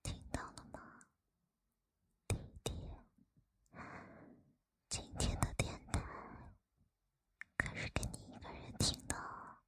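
very soft close whisper; right in your ear. Acted. Teasing the listener up close.

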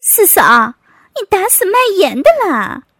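big, booming outcry; loud yell. A narrator's reading. Brother-in-law spitting out oversalted food, yelling. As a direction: holler it in loud protest. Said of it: "大声嚷道".

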